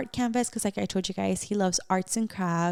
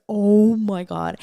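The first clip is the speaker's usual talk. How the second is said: slow and loud, reliving sharp pain; pained, drawn out; 8 dB louder, much slower than usual. Retold or imitated. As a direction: stretch it out like it still hurts. Reliving tearing off her nail.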